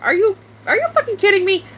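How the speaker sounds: high-pitched, disbelieving retort; incredulous outrage. Retold or imitated. Streamer retelling their reaction to Simp acting dumb, incredulous. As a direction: throw it out as an exasperated question.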